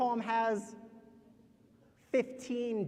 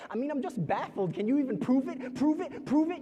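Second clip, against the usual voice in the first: escalating demand, higher and louder each time; baffled challenge; 8 semitones higher, 8 dB louder than usual. Acted. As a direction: press for proof, building insistently. Baffled doubter demands proof in poem.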